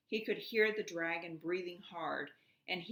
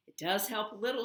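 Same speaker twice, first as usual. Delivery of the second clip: shivering; 4 semitones higher, 6 dB louder, faster than usual. A narrator's reading. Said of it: "shivered the dragon".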